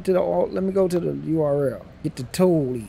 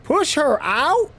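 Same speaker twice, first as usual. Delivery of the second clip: high, slow, louder disbelief; incredulous; 10 semitones higher, 4 dB louder, much slower than usual. Real, not acted. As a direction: drag out the shock. Game suggests pushing someone.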